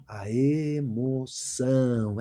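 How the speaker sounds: "emoção" very slow, low and soft; low, lingering "a emoção"; drawn-out emotive emphasis. Shown as a demonstration. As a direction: stretch every syllable of "emoção" dramatically. Teacher acts out emotion.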